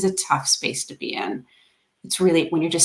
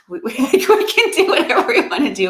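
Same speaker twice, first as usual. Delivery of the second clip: laughing mock boast; 7 semitones higher, 7 dB louder than usual. Mocking over-confident thought.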